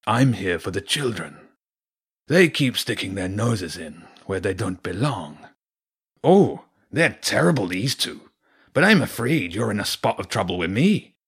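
menacing wolf with theatrical swoops; Garor Ulf. Acted. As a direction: play a smooth, threatening wolf villain. Voices the wolf villain Garor Ulf.

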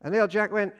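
normal talking voice

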